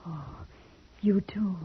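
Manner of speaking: whisper. Acted. Wife confides kinship with forest.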